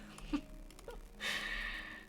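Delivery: airy giggle under the breath; wheezing laughter; breathless silent laugh. Real, not acted. Laughing at own goofiness.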